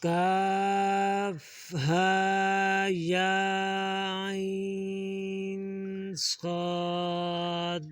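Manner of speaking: slow chanted letter names for the class; stretched madd. Shown as a demonstration. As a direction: hold each letter long in tajweed style. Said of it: "मद खींचेंगे और हा और या के ऊपर मद्दा है".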